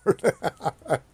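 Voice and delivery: giggling through the words; laughing. Real, not acted. Laughing at the cohost.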